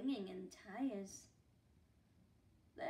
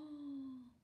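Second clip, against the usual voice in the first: gasp; 2 semitones higher, 5 dB quieter than usual. Acted. Reader surprised at page.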